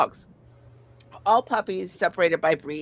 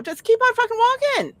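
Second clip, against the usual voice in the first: much higher, louder mock snap; exasperated brush-off; 4 dB louder than usual. Telling a pushy guy to keep walking, high loud scoff. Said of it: "And I'm like".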